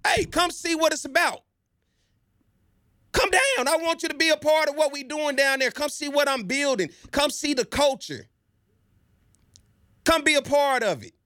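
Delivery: high, eager invitation to come visit; excited pitch to Rich. Retold or imitated. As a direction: call him down like a hype man. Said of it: "By just saying".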